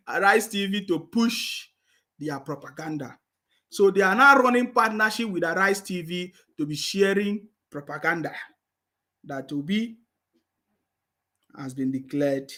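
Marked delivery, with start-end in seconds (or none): none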